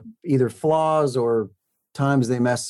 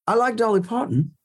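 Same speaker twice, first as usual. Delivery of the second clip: a hammed-up English cabbie accent, pitched much higher; mock English accent; 10 semitones higher than usual. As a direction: overdo a London cabbie accent for laughs. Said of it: "let's just see how offensive I can get".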